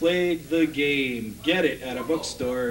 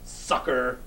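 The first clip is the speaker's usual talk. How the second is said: gruff sneer; 4 semitones lower than usual. Retold or imitated. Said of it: "said gruffly with a sneer, sans accent".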